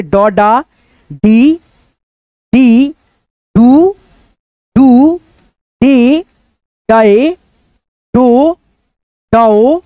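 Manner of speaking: drawn-out, sing-song recital of each syllable; syllable drill. Shown as a demonstration. Teacher reciting the ज syllable chart to students, sing-song. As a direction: chant the barakhadi one by one for pupils. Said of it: "उसी प्रकार से लिखेंगे".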